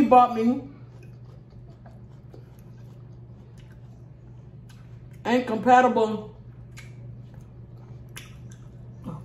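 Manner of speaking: speaking between bites; talking while chewing. Real, not acted. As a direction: mouth partly full of food. Seen 3: Eats steadily; hand and fork at mouth while speaking.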